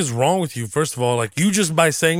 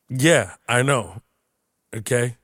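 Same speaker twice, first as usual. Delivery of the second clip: ordinary talk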